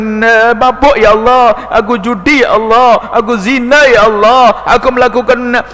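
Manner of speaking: loud, pleading cry of confession; confessing plea. Acted. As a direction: cry out your sins to God. Loud confessing prayer to God.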